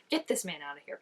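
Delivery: ordinary delivery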